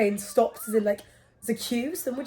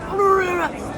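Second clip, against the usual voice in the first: higher, louder no no no; emphatic refusal; 8 semitones higher, 7 dB louder than usual. Retold or imitated. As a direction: wave her off insistently. Pizza man refusing queue.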